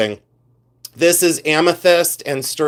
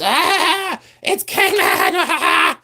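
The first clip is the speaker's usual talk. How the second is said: long, very high drawn-out shriek; mock scream; much slower than usual. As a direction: wail it out in hammed-up reseller excitement. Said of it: "Do I have to scream about it?".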